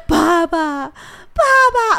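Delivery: high, loud imitated child's wailing; crying for dad. Mother imitating daughter crying after dad, high loud wailing. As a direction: wail like a toddler left behind. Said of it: "一直在那边".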